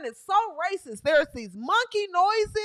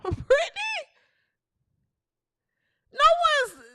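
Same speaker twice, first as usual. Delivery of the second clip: laughing squeal; 11 semitones higher than usual. Acted. Laughing mock falsetto.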